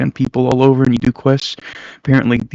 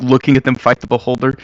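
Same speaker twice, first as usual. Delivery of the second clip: ordinary talk, nothing marked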